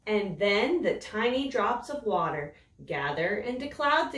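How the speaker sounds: slow, expressive read-aloud for little ones; storybook reading. A narrator's reading. Teacher reading a rain book to young children, expressive storytelling. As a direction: savour each line like bedtime story.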